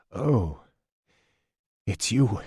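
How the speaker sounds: relieved, a little subdued; thick with relief. A narrator's reading. Wounded man recognising who tends him, thick with relief. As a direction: let relief thicken the words. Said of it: "His voice was thick with relief".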